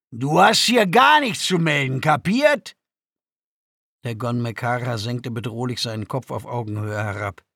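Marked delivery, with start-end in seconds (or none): 0.1-2.7 loud, raised-pitch intimidation; aggressive put-down. A narrator's reading. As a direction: put an uppity prisoner in his place. Guard puts the prisoner down. (grob und laut zurechtweisend)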